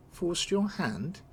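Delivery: slow, rising disbelief; incredulous. Acted. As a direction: throw the words back at her. Incredulous reply to cousin's excuse.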